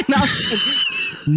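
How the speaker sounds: high burst of laughter; laughing. Real, not acted. Laughing at own story.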